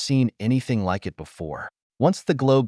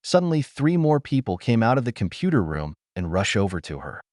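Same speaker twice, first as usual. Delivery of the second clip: plain reading voice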